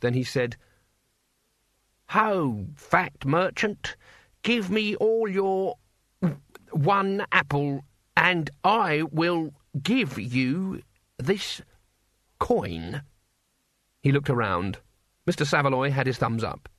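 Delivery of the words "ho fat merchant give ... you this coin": very slow, higher, word-by-word declaiming; stilted rehearsed recital. A narrator's reading. Cohen recites his lines to the apple merchant, stilted. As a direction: recite a memorised script with halting effort. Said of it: "as if he were rehearsing a script".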